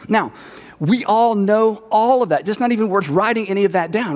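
ordinary talk, nothing marked

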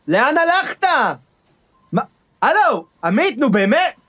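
high, rising indignant protest into the phone; outraged, getting shriller as he's ignored; indignant pleading. Acted. Guest protesting to his boss on the phone, high indignant pleading.

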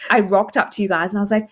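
ordinary talk

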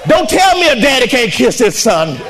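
shouted, climactic pulpit fervor; full-force preaching. Acted. Preacher telling the prodigal's homecoming, shouting. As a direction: build the story to its peak.

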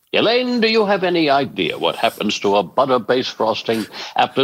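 rich, musical, grandiloquent delivery; Peterman voice. Shown as a demonstration. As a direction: savour every phrase like a piece of music. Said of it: "everything that I said to Elaine".

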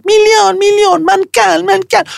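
put-on high, childish chanted screech; a grown man yelling like a spoiled child; mock demanding shout. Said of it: "והוא צועק". (חיקוי של צעקות ילדותיות, בקול גבוה)

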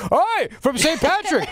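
high, slow, hammed-up Irish brogue; mock Irish accent. Host ridiculing the governor's answer to the reporter, mock Irish accent. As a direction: mimic a put-on Irish leprechaun voice.

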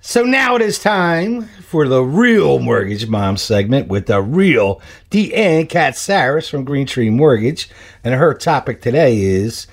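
slow, high, drawn-out radio introduction; big game-show style build-up, taken slowly; segment announcer. Acted. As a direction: announce the guest with showy, stretched words.

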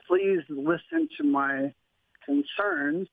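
usual speaking voice

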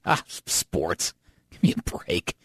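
dismissive scoff. Retold or imitated. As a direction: brush sports off with disdain. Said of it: "who just".

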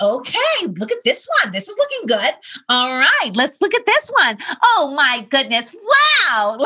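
high, bright-eyed sing-song; chipper nurse. Retold or imitated. Imitating the nurse scanning eggs on ultrasound, chipper and high. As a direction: gush over each egg like a game-show host. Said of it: "very chipper".

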